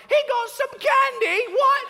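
very high, loud shriek; hammed-up squealing kid shout; outraged little girls. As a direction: shriek it like scandalized sisters. Sisters outraged over the candy.

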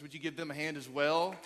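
ordinary delivery

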